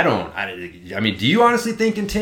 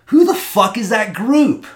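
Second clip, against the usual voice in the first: high, loud incredulous demand; loud, high, fed-up disbelief; exasperated outburst; 9 semitones higher, 6 dB louder than usual. Retold or imitated. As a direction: blurt it out in disbelief. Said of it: "and you're like".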